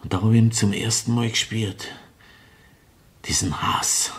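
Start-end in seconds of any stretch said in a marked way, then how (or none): 3.2-4.1 tonloses Flüstern; geflüstert. Acted. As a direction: den Hass leise aushauchen. Mörder erinnert sich an Hass. (geflüstert)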